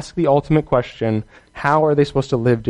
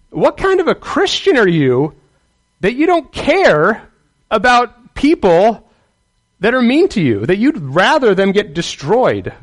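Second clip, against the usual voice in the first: God reproving a believer; 8 semitones higher, 3 dB louder than usual. Acted. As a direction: challenge them in a raised pitch, pausing between phrases. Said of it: "I think God's saying".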